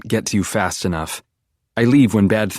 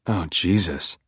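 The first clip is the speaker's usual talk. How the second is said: ordinary delivery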